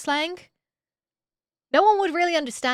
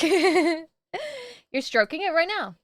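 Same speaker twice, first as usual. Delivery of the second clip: laughing; 4 semitones higher, slower than usual. Real, not acted. Laughs at chat's comment.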